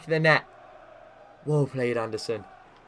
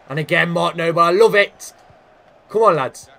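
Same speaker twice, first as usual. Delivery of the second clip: loud, higher cheer; excited cheering; 3 semitones higher, 9 dB louder than usual. Real, not acted. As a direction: roar the team on. Cheering his captain's tackle.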